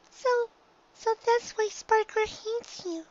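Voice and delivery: high, small filly voice; a little filly piecing it together; young filly Dinky. A narrator's reading. Filly to her mother, working it out, high and small.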